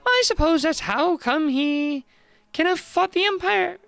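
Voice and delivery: high-pitched, lively grumbling voice; disgruntled slangy busybody. Acted. Busybody writes off the grumpy old hero, high and disgruntled. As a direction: grumble in a high, animated character voice.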